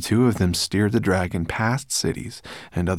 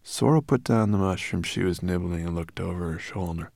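ordinary delivery

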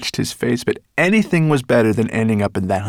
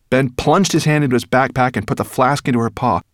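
usual speaking voice

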